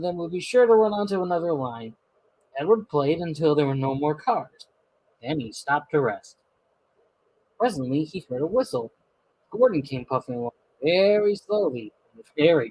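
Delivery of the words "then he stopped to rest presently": plain reading voice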